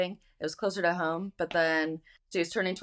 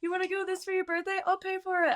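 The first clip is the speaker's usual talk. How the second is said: much higher, breezy mock voice of her careless self; carefree careless self. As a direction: toss it off sky-high like nothing matters. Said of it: "I just am like".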